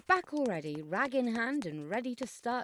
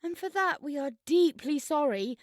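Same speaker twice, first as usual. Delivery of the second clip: urgent plea; 6 semitones higher, 7 dB louder than usual. Acted. Desperate to leave the haunted hall.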